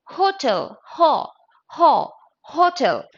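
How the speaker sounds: "hotel" and "ho" each with audible H; sounded H. Shown as a demonstration. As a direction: drill the H in "ho, hotel", spaced out. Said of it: "It is hotel".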